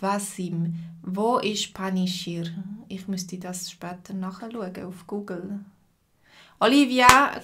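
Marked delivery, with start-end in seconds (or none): none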